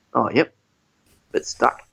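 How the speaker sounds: low, understated deadpan; deadpan Australian. Retold or imitated. Fireman sizing up a stuck man, mock deadpan Australian. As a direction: imitate an unfazed Aussie fireman. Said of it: "total deadpan Australian".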